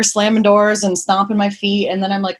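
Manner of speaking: normal talking voice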